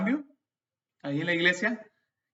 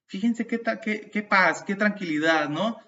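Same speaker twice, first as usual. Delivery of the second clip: ordinary delivery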